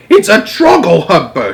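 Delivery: loud, high outburst; furious shout. A narrator's reading. Giant shouts at Sophie what he has caught, loud and high. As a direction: bellow the name. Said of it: "he shouted".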